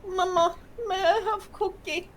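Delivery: put-on high pleading child whine; whiny little kid voice. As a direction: beg like a small kid. Child begging for cookie.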